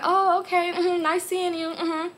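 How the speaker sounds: sugary dismissive politeness; keeping it cordial, mock sweet; fake polite. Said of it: "So I'm like".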